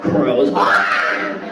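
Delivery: ordinary delivery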